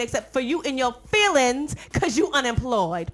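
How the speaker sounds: ordinary talk, nothing marked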